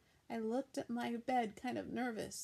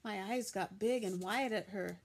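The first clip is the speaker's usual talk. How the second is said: ordinary delivery, nothing marked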